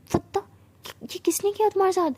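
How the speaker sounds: shocked shout. Acted. Siya confronts Tara over the bite marks, shouting. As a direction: demand it loudly, voice jumping up. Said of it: "Siya ऐसे ही चिल्ला के बोलती है".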